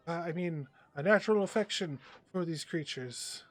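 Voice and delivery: hesitant showman pitched a little high, with emphatic bursts; showman's address. Acted. Game master voices the bird performer to an audience, showman's address. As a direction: address a crowd grandly, fumbling for words. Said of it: "he goes out there and he says".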